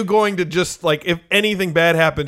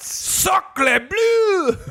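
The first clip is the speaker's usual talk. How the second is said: high, shouted French exclamation; french chef; 13 semitones higher than usual. Acted. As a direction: cry it out like a startled French chef. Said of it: "Very good, [name]".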